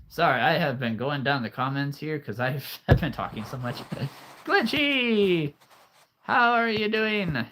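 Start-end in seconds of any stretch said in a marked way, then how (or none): 4.5-7.5 high, drawn-out happy greeting; excited greeting. Real, not acted. Greeting a chat viewer by name.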